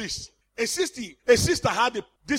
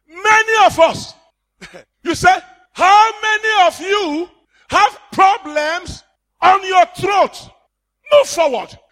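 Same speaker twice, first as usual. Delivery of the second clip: loud, higher altar-call shout; preaching shout; 6 semitones higher, 9 dB louder than usual. Acted. Preacher mocking healing crusade calls, loud shout. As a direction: call them forward with full force.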